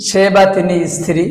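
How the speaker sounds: ordinary talk, nothing marked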